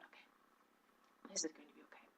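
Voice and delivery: very soft, low murmur; calm yourself under your breath; quiet self-reassurance. Retold or imitated. Calming herself after the panic.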